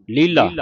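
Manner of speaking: ਲੀਲਾ with a weighty h-coloured second l, falling; heavy, aspirated l. Shown as a demonstration. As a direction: lean heavily on ਲੀਲਾ, "leelha". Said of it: "ਭਾਰਾ ਬੋਲਣਾ ਇਹਨੂੰ".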